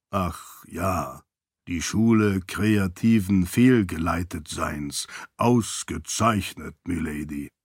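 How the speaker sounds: low, slow, measured voice; deferential attendant. A narrator's reading. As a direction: play along with stately, unhurried deference. Archie dryly plays along with lady. (ergebener Diener, tief und gemessen)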